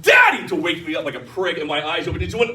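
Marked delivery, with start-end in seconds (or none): 0.0-0.5 high, sharp mock shout; hammed-up high-pitched kid call; demanding child's yell. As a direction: imitate a toddler yelling someone awake. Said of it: "He literally just went".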